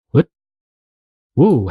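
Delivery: ordinary talk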